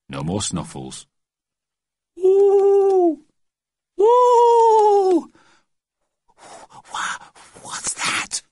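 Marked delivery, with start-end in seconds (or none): 2.2-5.3 long, high, loud owl hoot; eerie sustained high hooting. A narrator's reading. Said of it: "That's an owl". 6.9-8.4 slow, stammered, breathy whisper of fright; frightened boy, voice gone to breath; hoarse wobble. A narrator's reading. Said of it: "came out in a hoarse wobble".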